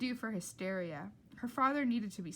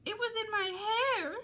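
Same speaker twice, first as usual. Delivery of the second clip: high, louder frantic squeal; panicked Rita; 13 semitones higher, 6 dB louder than usual. Acted. Rita squealing at the diner about her hair. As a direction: squeal it like she's about to faint. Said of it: "said Rita".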